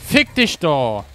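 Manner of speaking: loud furious cursing; angry shout. Real, not acted. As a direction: yell it at the enemy. Raging at enemy.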